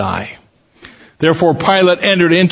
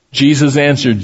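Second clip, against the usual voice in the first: usual speaking voice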